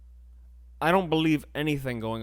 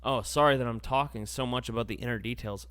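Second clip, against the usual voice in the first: usual speaking voice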